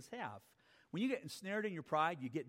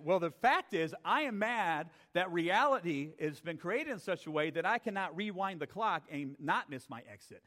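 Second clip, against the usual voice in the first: normal talking voice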